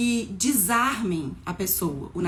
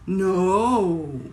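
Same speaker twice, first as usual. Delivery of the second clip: acted refusal; 2 semitones lower, 5 dB louder than usual. Shown as a demonstration. Acting out words with mime.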